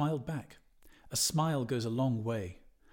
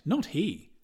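emphatic denial; 5 semitones higher, 5 dB louder than usual. Acted. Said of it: "Martin Cunningham cried".

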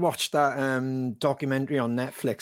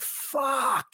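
mock stunned swear; 12 semitones higher than usual. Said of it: "I was like".

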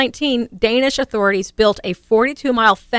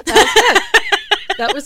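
laughing; 9 semitones higher, slower than usual. Real, not acted. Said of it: "laughing after the story".

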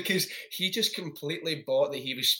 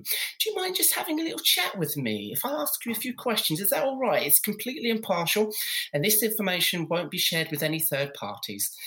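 much higher, camp survey caller; terribly camp voice; 6 semitones higher than usual. Retold or imitated. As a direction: lay the fake pollster charm on thick. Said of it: "all in this terribly camp voice".

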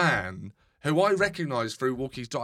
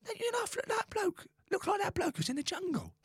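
much higher, softer London neighbour voice; imitating neighbour Yvonne; 13 semitones higher, 7 dB quieter than usual. Retelling the dog-walking neighbour, voiced high and soft. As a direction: lightly squeak out the old neighbour's lines. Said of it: "She goes".

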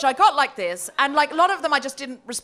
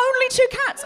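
mock shocked; 12 semitones higher, 6 dB louder than usual.

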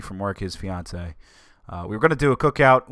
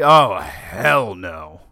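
emphatic; 7 dB louder, much slower than usual. Real, not acted. Answering his own rhetorical question.

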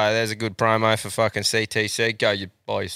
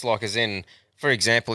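normal talking voice

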